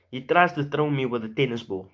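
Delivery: ordinary delivery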